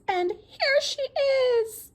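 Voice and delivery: put-on high, slow, loud baby bear cry; shrill wee voice. Baby bear discovers Goldilocks, shrill wee voice. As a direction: stretch it out in a shrill little squeal. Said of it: "wee voice of the baby bear was so shrill".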